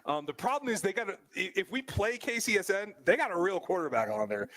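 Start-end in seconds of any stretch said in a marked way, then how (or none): none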